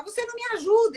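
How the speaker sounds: mock reproach, pitched very high; quarrelling complaint. Wife accuses husband of not helping, reproachful. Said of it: "ela briga com ele".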